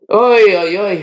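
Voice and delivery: loud, high Yiddish-style oy-oy; storyteller's hammed-up lament; acted lament. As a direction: cry out in mock grief at bad news. Host hears of a death.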